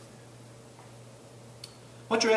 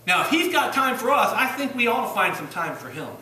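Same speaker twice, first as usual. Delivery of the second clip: higher and louder, pressing the point; preacher driving it home to the church, louder; full preaching; 6 semitones higher, 6 dB louder than usual. Acted.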